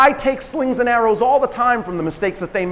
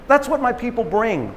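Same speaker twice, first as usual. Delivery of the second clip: ordinary talk, nothing marked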